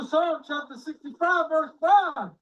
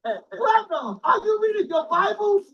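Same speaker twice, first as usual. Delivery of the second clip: raised rebuke; 6 semitones higher, 3 dB louder than usual. Real, not acted. Preacher rebukes the brother.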